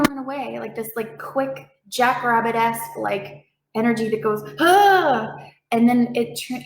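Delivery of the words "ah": sudden high mock yelp of alarm; short high startled cry. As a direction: jump like a spooked jackrabbit. Body's panic energy voiced.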